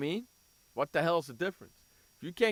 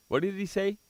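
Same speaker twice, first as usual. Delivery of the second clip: ordinary talk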